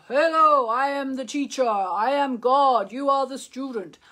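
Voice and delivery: loud, high mock proclamation; self-important guru. Host mocking what her teacher would never say, pompous proclamation. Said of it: "my teacher doesn't say".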